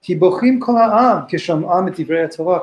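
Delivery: verse reading. Real, not acted. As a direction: read the verse with lift.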